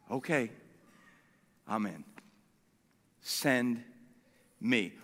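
very low, soft, with long pauses; humble surrender. Acted. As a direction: offer yourself to God in a hushed, halting reply. Isaiah undone, quietly accepts the call.

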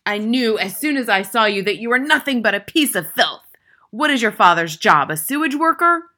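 loud, high, contemptuous tirade; furious bellow. A narrator's reading. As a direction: rage at the boy, rising into the final question. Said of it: "she bellowed".

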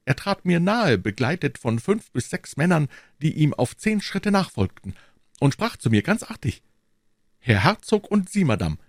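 high-pitched character voice, recounting; Aramis. Acted. Aramis recounting the encounter to his friends, high-pitched.

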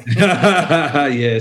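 amused laugh on the yeah; laughing yes. Real, not acted. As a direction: laugh through the word. Laughing at gateway drug remark.

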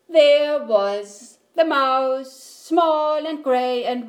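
loud, slow, drawn-out narration; dismayed reveal. A narrator's reading. Narrator finds mouse in the kettle, loudly dismayed. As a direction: stretch the discovery in exasperation.

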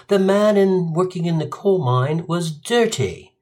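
slow, prim British judge; lightly voiced British host; proper British. British judge gives Arnie a sentence, prim British. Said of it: "British. You know, very proper".

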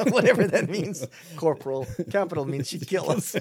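giggling at her own slip; amused laughter while correcting herself; laughing. Real, not acted. Laughing at capital/corporal mixup.

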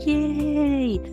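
high, jubilant yay; excited cheer. Acted. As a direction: cheer it out with delight. Cheering the good money news.

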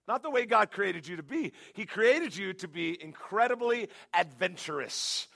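ordinary talk

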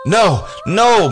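high loud panicked no; inner scream. Acted. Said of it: "Mary screamed inside her head".

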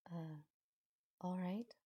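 hesitant, dazed acceptance; hesitant answer. A narrator's reading. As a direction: manage a small, uncertain reply. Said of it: "That's all she manages to answer".

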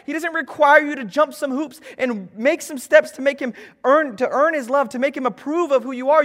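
impassioned altar-call preaching; preaching at full force. Acted. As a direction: push the message home to the room.

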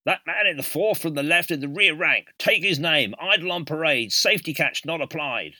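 barked parade-ground shout, high and loud; drill sergeant. Retold or imitated. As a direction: bellow it across the parade ground. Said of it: "then shout".

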